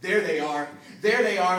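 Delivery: loud rising yell, a man spotting them; a Texan shouting an alarm. A narrator's reading. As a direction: bellow it out across the border. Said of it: "as a Texan yells".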